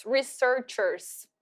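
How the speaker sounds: very slow "researchers"; careful drill; model pronunciation. Shown as a demonstration. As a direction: stretch out "researchers" for the class. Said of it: "a forma que eu coloquei pra vocês conseguirem pronunciar".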